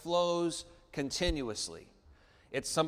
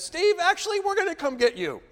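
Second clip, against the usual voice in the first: high, louder British instructor, suddenly urgent; British guide changing his mind, calling out higher; British accent; 7 dB louder than usual. Retold or imitated. Instructor calling urgently to boy near rapids, British accent. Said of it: "And he says".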